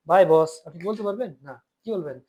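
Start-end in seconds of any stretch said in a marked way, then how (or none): 0.1-0.7 loud, offhand "bye boss"; a wrong, overly casual farewell shouted to the boss; too casual to the boss. Retold or imitated. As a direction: toss off a careless goodbye, much louder. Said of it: "অফিসের বস সাথে বলতে পারবেন না".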